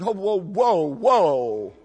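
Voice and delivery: loud, mock-alarmed whoa; alarmed halt. Dad stopping his boy's new way of talking, loud alarm. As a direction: cut him off loudly, like halting a horse. Dad halting son's affected talk.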